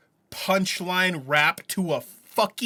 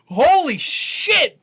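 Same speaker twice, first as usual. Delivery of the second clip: amazed; 11 semitones higher, 5 dB louder, much slower than usual. Real, not acted. Amazed reaction.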